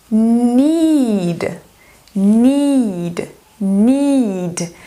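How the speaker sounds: "need" with a long drawn I; teacher modelling "need" for students, long I. Shown as a demonstration. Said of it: "esse daqui é o som de I, I, I".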